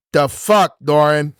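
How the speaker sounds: put-on light toddler voice echoing a swear; toddler parroting dad. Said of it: "you hear him go".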